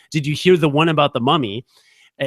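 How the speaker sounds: ordinary delivery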